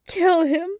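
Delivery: high, loud tragic imploring; hammed-up tragic appeal; tragic plea. A narrator's reading. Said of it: "she implored tragically".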